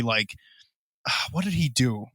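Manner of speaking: ordinary talk, nothing marked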